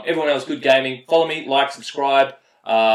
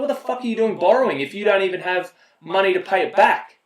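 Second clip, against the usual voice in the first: higher, exasperated demand; incredulous outburst; 7 semitones higher, 3 dB louder than usual. Retold or imitated. Player confronting a freeloading teammate, higher and exasperated. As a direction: demand an answer, fed up. Inner rant at borrower.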